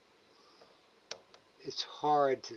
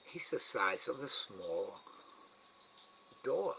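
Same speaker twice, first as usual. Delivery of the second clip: usual speaking voice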